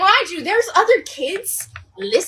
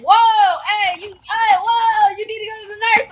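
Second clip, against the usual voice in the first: higher-pitched, startled friend impression; mock alarm; 5 semitones higher, 4 dB louder than usual. Friend sees her vomit and sends her off, mock alarm.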